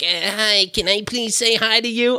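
a hint of timid, polite fanboy; nerdy. Nerdy guy behind asking to say hi, lightly mocked nerd voice.

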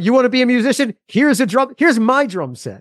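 high, eager cool-parent voice. Retold or imitated. Guest imitating today's cool parents offering a drum set, high and eager. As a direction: play the encouraging modern parent handing over a drum.

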